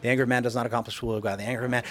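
fast, soft rattling off of the verse; rattling off the verse over and over; rapid repeated recital. Retold or imitated. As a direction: race through it like a mantra, quietly. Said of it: "said that verse seven hundred times".

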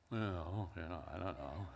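low, soft, hesitant mumbling; trailing, muted sheepish reply; sheepish mumble. Retold or imitated. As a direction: mumble it low like a husband caught out. Sheepish reply to wife's rebuke.

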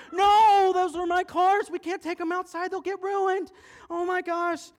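put-on high, very loud, frantic child; horrified yelling. Boy refusing to let cars go outside, horrified yelling. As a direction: wail the objection at the top of your voice.

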